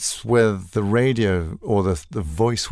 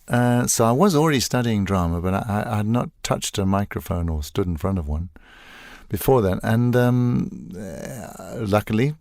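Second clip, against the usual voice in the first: usual speaking voice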